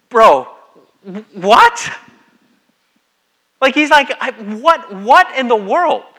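loud, high, disbelieving "what in the world"; exasperated Jesus. Retold or imitated. Jesus fed up with his disciples, calling out loud and high. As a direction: throw your hands up in disbelief. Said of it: "he's throwing his hands up in the air and he's going".

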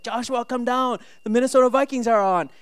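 put-on high, excited dad calling his kid; high, eager call across the house; calling out excitedly. Calling his son down to watch.

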